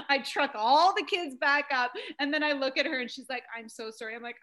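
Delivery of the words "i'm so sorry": much softer, hushed apology; retold tearful apology. Secretary apologizing to a crying mom, hushed. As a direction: voice a sorry secretary very softly. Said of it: "she's now crying for me".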